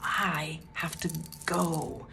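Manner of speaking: slow, barely voiced mouthing; mouthed words. To the roofers watching from the roof, slow near-silent mouthing. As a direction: mouth it slowly, almost silent, with hand signals. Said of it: "I'm doing hand signals".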